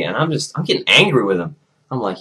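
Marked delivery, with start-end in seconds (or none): none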